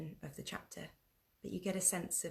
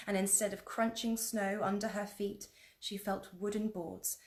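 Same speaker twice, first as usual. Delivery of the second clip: plain reading voice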